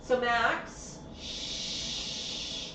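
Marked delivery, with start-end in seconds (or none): 1.2-2.7 soft voiceless shush. Real, not acted. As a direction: hush the excited bird. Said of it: "I know you're excited".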